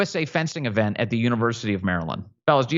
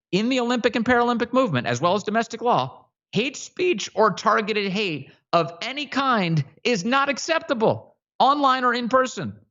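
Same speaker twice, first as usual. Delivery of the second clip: mocking read; 10 semitones higher than usual. Mocking read of the fencing statement.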